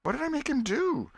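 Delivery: put-on an anxious old aunt begging for the dream; Aunt Polly voiced. Aunt Polly questions Tom.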